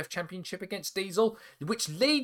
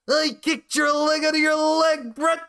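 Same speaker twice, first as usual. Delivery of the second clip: high, loud shouted quote; Owen Hart shouting; 12 semitones higher, 6 dB louder than usual. Retold or imitated. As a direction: yell it like an angry wrestler on the mic. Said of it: "or in Owen Hart's words".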